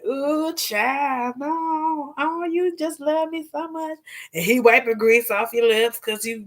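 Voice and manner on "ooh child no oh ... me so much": flirty giggly voice. Retold or imitated. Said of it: "laughing and hee-heeing and a kiki".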